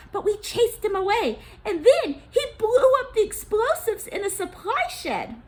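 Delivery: high, eager official voice; Gozan reporting. Acted. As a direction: hurry to inform the president.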